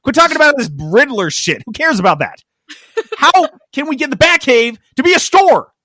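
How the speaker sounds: mock greedy executive talking over the comic writer; impatient executive. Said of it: "who's waving his hand during the whole thing".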